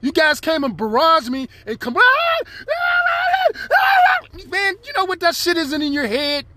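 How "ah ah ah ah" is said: high yelled ah ah sounds; mock screaming. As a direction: yell ah ah like the voices. Imitating voices screaming in head.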